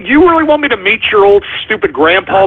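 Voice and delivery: ordinary talk, nothing marked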